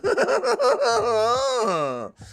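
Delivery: very high drawn-out cackle; hammed-up gloating laugh; mock villain cackle. Streamer pretending greed over donations, high mock cackle.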